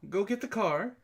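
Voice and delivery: ordinary delivery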